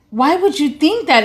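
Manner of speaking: retold upset. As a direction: imitate his upset disbelief, without shouting. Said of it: "And he got upset".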